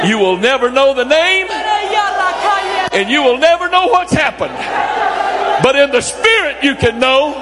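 preaching at full force. Acted. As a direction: pray it out with fire. Leads intense prayer at full force.

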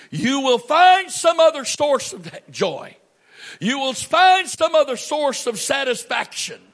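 high, loud warning shout; loud high shouted prophecy; preaching at full force. Real, not acted.

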